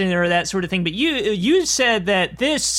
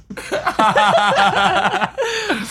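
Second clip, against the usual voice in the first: loud laughter; 4 semitones higher, 3 dB louder than usual. Real, not acted. Group laughing at the joke.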